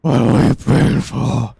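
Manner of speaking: slow, loud Batman-like demon; a brooding cursed statue speaking. Acted.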